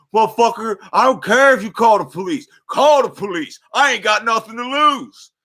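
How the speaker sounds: high, loud mock defiant shouting; hammed-up woman daring him; imitated cussing woman. The lady daring him to call the police, mock shouting. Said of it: "She's like".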